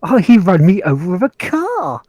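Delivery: posh British gamer. Pretend. Mock posh polite gamer.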